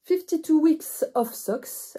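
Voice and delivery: hammed-up English accent for the book title; playful put-on English accent; self-mocking English accent. Shown as a demonstration. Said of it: "avec mon superbe accent anglais".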